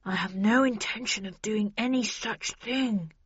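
slightly muffled, understated reply. A narrator's reading. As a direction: speak as if face pressed to floor. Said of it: "he said in a muffled voice".

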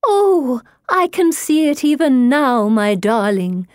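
high, loud, swooning reminiscence; rapturous spirit-mother. A narrator's reading. Medium as dead mother recalls an invented garden, high gushing rapture. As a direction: gush dreamily in a high, swelling voice.

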